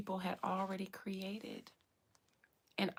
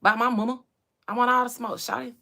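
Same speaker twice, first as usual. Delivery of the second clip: Atlanta rapper impression; 10 dB louder, faster than usual. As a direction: hype it up like an A-Town rapper. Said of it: "Ain't that how y'all say it in Atlanta?".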